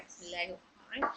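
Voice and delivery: soft quick recited basmala; recitation. Acted. Teacher opening practice with the basmala, soft. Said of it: "चलिए पढ़ते हैं".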